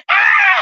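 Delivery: sudden high scream; a short shrill shriek of panic; retold scream. Retold fire scream in nightclub.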